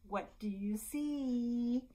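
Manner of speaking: slow, drawn-out question; picture-book read-aloud. Acted. Librarian reading Brown Bear to babies, slow singsong. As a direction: stretch it out for little listeners.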